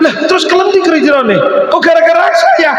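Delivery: high, shouted disbelief; can't believe it's his fault; old man stunned by the blame; shocked protest. Acted.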